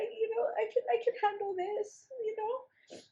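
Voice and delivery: high, tight, near tears; words squeezed out high and strained. Real, not acted. Emotional, voice rising high.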